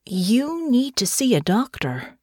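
coaxing her gently, as if she's fragile; said slowly. A narrator's reading. Irene soothing a battered Natasha, slow and careful. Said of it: "trying to care for a very elderly person".

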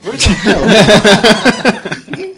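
laughing through the words; words half swallowed by a laugh. Real, not acted. Laughing mid-line.

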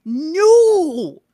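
high, loud, drawn-out cry; emphatic refusal. Retold or imitated. Mom refusing to put up with it, exasperated cry. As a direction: stretch the word into a loud protest.

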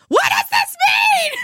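high, slow frantic shriek; panicked high shriek. Retold or imitated. Panicked lady quoted.

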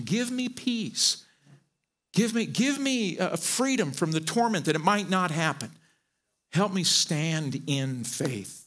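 slow, soft prayer to God; hushed slow plea for peace; earnest prayer. Acted. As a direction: pray it slowly and softly. Prays aloud to God.

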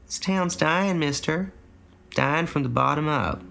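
put-on mournful old woman telling it straight; lightly voiced old wife, resigned; old farm wife. A narrator's reading. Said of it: "She said".

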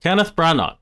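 "Branagh" high, with a hard stop on the end; mock pronunciation. Shown as a demonstration. Co-host stubbornly repeating the actor's name, "Branagh" mispronounced with a hard ending. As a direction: insist on "Branagh" with a hard final consonant. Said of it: "no G sound whatsoever".

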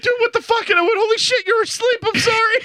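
high-pitched flustered re-enactment; startled apology. Retold or imitated. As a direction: act caught off guard, then apologetic. Retelling startled reaction on waking friend.

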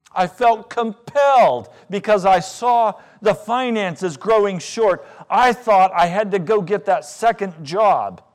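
loud, slow-building sermon cry; loud preacher's fervour; full-force preaching. Acted. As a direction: preach it hard from the pulpit.

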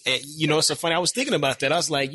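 normal talking voice

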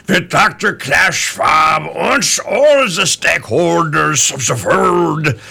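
slow, booming mock German accent; imitating Klaus Schwab with a hammed-up German accent; Klaus Schwab impression. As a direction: ham up a bad German movie accent over and over slowly. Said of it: "if I can do his voice".

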